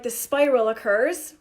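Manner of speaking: usual speaking voice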